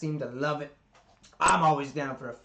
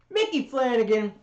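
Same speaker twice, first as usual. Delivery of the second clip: hype announcement; 9 semitones higher, 7 dB louder than usual. Real, not acted. Announcing the comedian's name.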